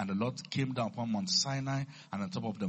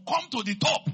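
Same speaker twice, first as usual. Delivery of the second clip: high, loud summons from above; booming divine command; commanding call; 10 dB louder than usual. Acted. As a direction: call out as God ordering Moses upward. Acts God calling Moses up.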